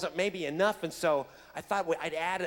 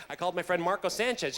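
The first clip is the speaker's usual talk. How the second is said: ordinary delivery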